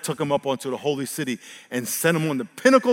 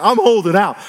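stubborn declaration; 5 semitones higher, 10 dB louder than usual. Retold or imitated. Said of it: "I was like".

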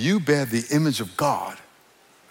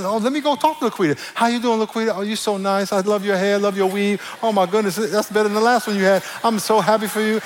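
higher-pitched gushing flattery; sugary fake niceness; 5 semitones higher than usual. Churchgoer greeting Laquita who gossiped about them, sugary fake niceness. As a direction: gush compliments at someone you resent.

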